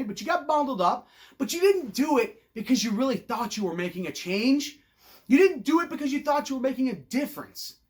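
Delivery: normal talking voice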